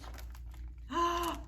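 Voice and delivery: sharp indrawn gasp of mock surprise; dramatic gasp. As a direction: gasp for the children.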